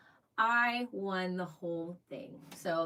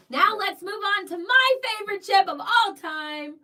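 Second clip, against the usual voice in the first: much higher and louder cheering announcement; hyped; 10 semitones higher, 12 dB louder than usual. Real, not acted. Introducing favorite cruise ship, loud excited whoops. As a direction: whoop it up like a fan.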